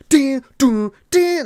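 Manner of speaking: high, loud "din, dun, din"; imitated movie zoom sting; dramatic sting sound. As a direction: punch out three mock suspense hits.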